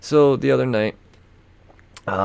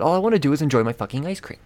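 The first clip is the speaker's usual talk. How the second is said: fast, higher, exasperated rant; frustrated outburst; 6 semitones higher, much faster than usual. Real, not acted. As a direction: blurt it out fed up and fast. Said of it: "I'm just frustrated".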